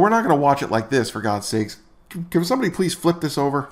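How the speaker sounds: normal talking voice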